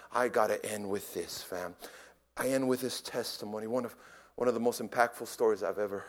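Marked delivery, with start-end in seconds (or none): none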